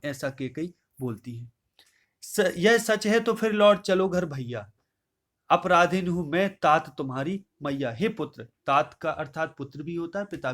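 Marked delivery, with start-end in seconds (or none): 2.2-7.9 raised, measured verse recital voice; poem recitation. Acted. Teacher reciting Kaikeyi's verse to the class, declaiming.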